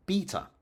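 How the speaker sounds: beta as BEE-tuh, starting high; Anglo-Latin pronunciation. Shown as a demonstration. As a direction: traditional British beta with an "ee" vowel. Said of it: "the older traditional Anglo-Latin pronunciation".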